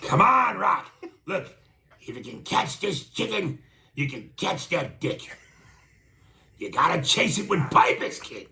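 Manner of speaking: put-on high-pitched old-school boxing trainer; Mickey. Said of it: "Can you do the part".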